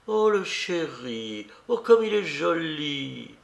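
slow, sugary false sweetness; honeyed voice. Acted. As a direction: coo it with fake sweetness. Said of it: "disant d'une voix mielleuse".

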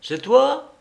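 voix d'homme au bout du fil, bien plus haute; lancée de loin, plus aiguë et plus forte; voix lointaine au téléphone. A narrator's reading. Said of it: "Une voix lointaine lui dit". (Béchoux au téléphone, une voix lointaine et haute)

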